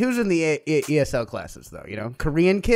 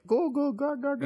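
put-on higher, softer baby-talk babbling; 9 semitones higher, 8 dB quieter than usual. Mocking the best Japanese speaker in Germany, baby talk. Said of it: "the baby talk instead of Japanese".

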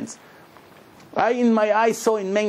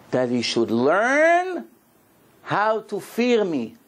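slow, weighty scripture reading; solemn slow quote; 3 semitones higher, much slower than usual. Acted. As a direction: lay each word down slowly like holy text. Quoting the Torah verse solemnly.

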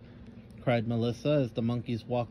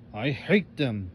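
plain reading voice

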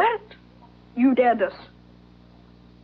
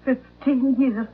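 ordinary talk, nothing marked

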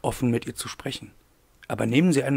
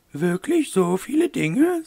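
high, slow, drawn-out lady's voice; questioning mademoiselle; 9 semitones higher, 4 dB louder, slower than usual. A narrator's reading. Eloire queries Wolf's many things, high slow questioning lady voice. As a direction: question him slowly in a high character voice.

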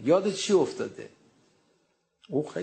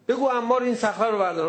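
higher, louder scornful demand; loud mocking challenge; 10 semitones higher, 6 dB louder than usual. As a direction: throw the dare at him loudly.

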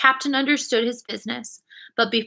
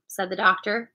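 usual speaking voice

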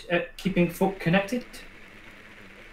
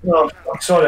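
ordinary delivery

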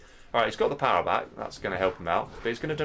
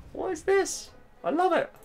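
very high falsetto squeal; delighted disbelief. Real, not acted. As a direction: squeak it out in real amazed delight. Amazed, delighted by surprise move.

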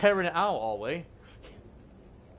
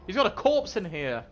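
usual speaking voice

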